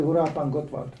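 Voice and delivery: normal talking voice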